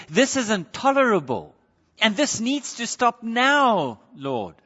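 high protest, leaning hard on now; exasperated demand. Acted. Psalmist telling God it is intolerable, exasperated. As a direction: demand relief, then drop on Lord.

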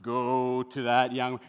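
low, drawn-out mock heavenly voice; mystical voice from above. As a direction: intone it slowly and low, like a voice calling from heaven. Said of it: "I didn't hear a voice saying".

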